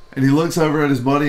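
ordinary talk, nothing marked

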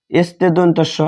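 normal talking voice

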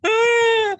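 hammed-up crying cry, very high and loud; mock wail. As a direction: let out a fake sobbing wail. Mock breakdown over yawning.

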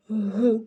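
"г" alone, breathed out as a hard consonant; hard consonant sound. Shown as a demonstration. Teacher gives children the first sound, hard "г". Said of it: "твердий".

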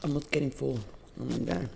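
talking between bites; chewing while speaking; mouth full of food. Real, not acted. Seen 2: Cheeks bulge, lips working while talking; fork over food plate.

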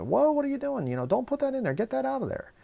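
imitating wife's high-pitched alarmed yell; retold yell. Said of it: "So my wife yelled at her".

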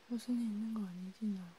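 hushed, half-whispered comfort to a crying friend. Acted. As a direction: soothe them gently, close to the mic.

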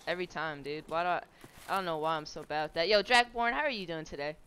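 usual speaking voice

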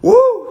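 high, loud jubilant whoop; joyful praise shout. Real, not acted. Shouting praise after a realization.

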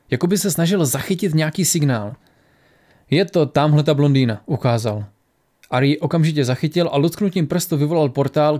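ordinary delivery, nothing marked

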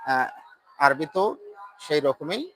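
ordinary talk

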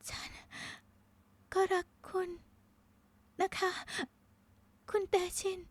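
high, faint gasps between words; broken, breath-starved farewell; last dying words. A narrator's reading. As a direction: struggle to speak on a last breath. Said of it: "คำพูดสุดท้ายหลุดจากปากของเธอ ก่อนลมหายใจสุดท้าย".

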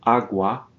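Agua with ua in one syllable; Spanish word demo. Shown as a demonstration. Teacher models Agua for students, clear Spanish. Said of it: "I'll jump in with my pronunciation".

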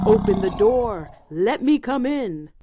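loud wolf voice; roaring wolf. A narrator's reading. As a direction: demand it like a hungry wolf. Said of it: "the wolf roared".

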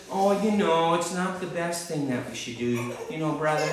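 soft, hesitant mock scolding; meek, mealy-mouthed imitation of a timid preacher; mock mild rebuke. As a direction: gently hedge it, as if afraid to offend. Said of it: "He doesn't just".

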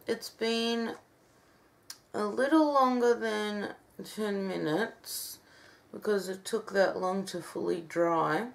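barely moving lips, stiff face; careful talking, mask cracking; stiff dried face mask. Real, not acted. Seen 0: Mask turns pale and dry during the words; earlier frames show it darker and wet.

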